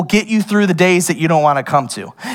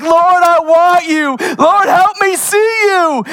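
mock desperate prayer; 11 semitones higher than usual. Preacher mocking a late-night prayer, high pleading cry.

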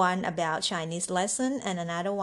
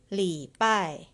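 ordinary delivery

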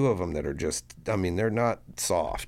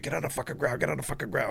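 rapid, high-pitched mimicked SWAT order; retold police command; 8 semitones higher, much faster than usual. SWAT officer ordering everyone down, retold urgent command. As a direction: rattle it off like an officer storming in.

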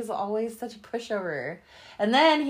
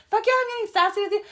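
fast, much higher sassy comeback; 12 semitones higher, 4 dB louder, much faster than usual. Retold or imitated. Answering Sam's sassy question, fast and sassy. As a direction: fire it back full of sass. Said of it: "Are you getting sassy with me?".

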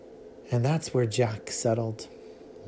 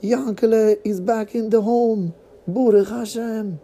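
high, loud joyful call to family; excited calling; 10 semitones higher, 4 dB louder than usual. Acted. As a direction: shout it out with joy. Father joyfully calls family.

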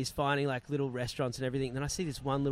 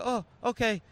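higher-pitched voice of dawning understanding; a hint of another man's higher voice; imitated phone friend; 8 semitones higher, 4 dB louder than usual. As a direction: lightly mimic a stranger accepting the answer.

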